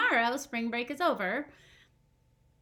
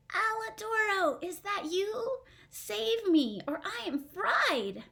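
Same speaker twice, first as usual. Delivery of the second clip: high, small puppet voice pleading; a tiny floury cry for help; 10 semitones higher than usual. Acted. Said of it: "In a tiny flour-filled voice".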